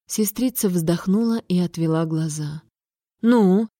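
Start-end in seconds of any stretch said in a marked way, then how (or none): 3.2-3.7 mildly stern prompt; a touch of strictness; stern question. A narrator's reading. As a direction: demand an answer, lightly stern. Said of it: "строго вопросила я".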